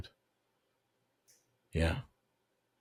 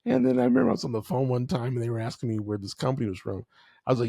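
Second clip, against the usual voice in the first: ordinary delivery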